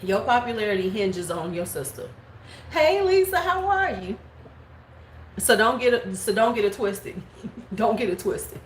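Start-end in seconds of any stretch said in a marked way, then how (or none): 2.7-4.2 fake sweet greeting.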